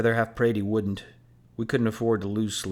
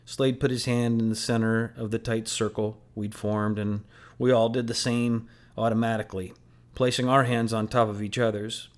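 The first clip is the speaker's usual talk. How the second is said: usual speaking voice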